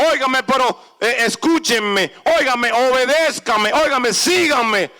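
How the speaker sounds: shouted pleas to the crowd; loud shouted commands to a crowd; calling out. Acted. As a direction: call the people to follow, loud and high. Moses calling to the people.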